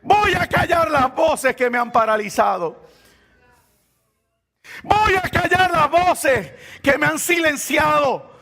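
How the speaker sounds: high, ringing declarations with long pauses; defiant full-force preaching. Real, not acted. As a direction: proclaim it as a vow from the pulpit.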